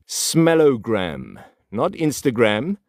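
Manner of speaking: Smellogram drawn out very slowly, then Instagram; slow stressed contrast. Shown as a demonstration. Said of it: "I'm talking about the world of smells".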